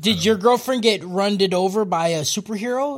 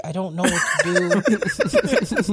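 laughing; much slower than usual. Pretend. Mock panic over jam addict.

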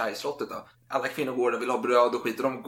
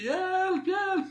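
very high, frantic cry for help; queen screaming help. Retold or imitated. Queen pounding on the king's door, high panicked cry. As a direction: shriek it at the locked door. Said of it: "Så hon skriker".

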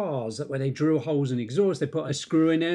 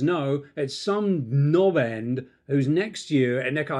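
ordinary talk, nothing marked

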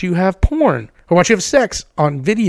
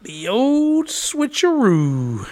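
high, slow, stretched-out catchphrase; playful sing-song; 5 semitones higher, 5 dB quieter, much slower than usual. Acted. Host capping guest's joke about a trap, playful drawl. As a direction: draw it out teasingly, higher and softer.

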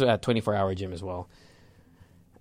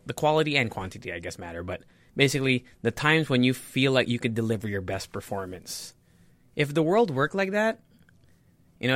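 ordinary talk, nothing marked

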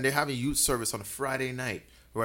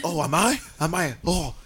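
put-on higher, somewhat loud drunk reply; retold loud drunk; 4 semitones higher, 4 dB louder than usual. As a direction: imitate a drunk unaware of their own volume. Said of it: "You're ma-, you're really loud".

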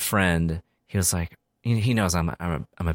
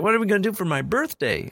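quoting friend; 11 semitones higher than usual. Retold or imitated. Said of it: "my friend was like".

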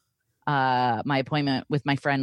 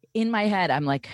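normal talking voice

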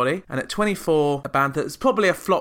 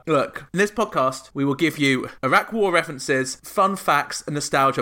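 normal talking voice

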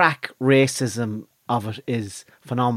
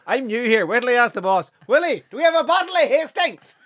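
put-on high Northern Irish accent; Northern shop assistant; 9 semitones higher than usual. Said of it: "off license in the north".

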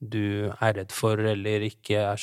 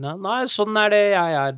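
mock stubborn refusal; 10 semitones higher, 5 dB louder than usual. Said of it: "være sta om at".

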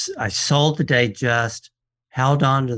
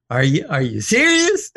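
amazed disbelief; 5 semitones higher than usual. Retold or imitated. Dealer retelling his shock at the pilot's story, amazed. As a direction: ask it like you can't believe your ears.

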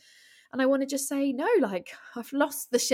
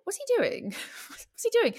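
puzzled; 13 semitones higher than usual. Retold or imitated. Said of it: "I thought".

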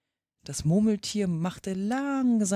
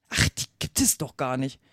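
lower-pitched, mildly irritated; annoyed; 6 semitones lower than usual. A narrator's reading. Alice disputing the Dormouse's tale, annoyed. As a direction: object with a touch of annoyance. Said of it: "fing Alice sehr ärgerlich an".

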